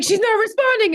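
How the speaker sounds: high, loud anxious worrying; fretful friend. Retold or imitated. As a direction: whine like he's been left waiting. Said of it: "And he's like".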